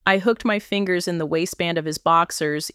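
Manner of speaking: ordinary delivery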